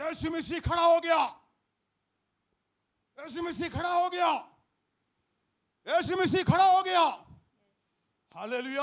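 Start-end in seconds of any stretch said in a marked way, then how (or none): 0.0-7.0 loud, higher triumphant declaration; preacher to congregation, rising loud proclamation; proclaiming loudly. Acted. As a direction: announce it like a victory.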